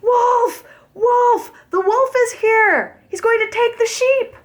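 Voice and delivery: high, loud desperate cry; yelling. Acted. Shepherd boy yelling at a real wolf, loud high panicked cry. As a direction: scream for help in real panic. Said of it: "and yelled".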